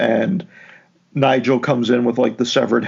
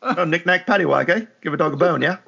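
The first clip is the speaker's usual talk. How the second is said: higher, sing-song mock British; 5 semitones higher, faster than usual. Co-host riffing on nursery rhyme in mock British. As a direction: ham up a nursery-rhyme cockney.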